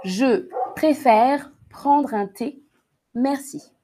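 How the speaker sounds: slow, carefully spaced words; slow dictation. Shown as a demonstration. Teacher dictating the sentence to students, slowly. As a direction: dictate it word by word for the class.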